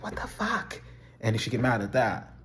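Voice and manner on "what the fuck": much higher, shrill mock outrage; mock outraged Claire. Much higher mocking Claire snapping at a walk invite. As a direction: squeal it like an offended girlfriend. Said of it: "She'd be like".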